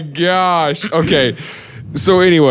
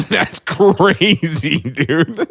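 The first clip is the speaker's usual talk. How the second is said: normal talking voice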